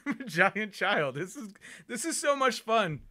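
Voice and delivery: high, giddy and laughing; giddy anticipation. Real, not acted. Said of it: "I'm so giddy right now".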